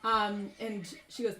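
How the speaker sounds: normal talking voice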